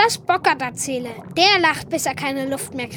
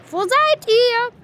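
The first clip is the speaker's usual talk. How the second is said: calling out; 6 semitones higher, 4 dB louder, slower than usual. Acted. Calling for hidden frogs.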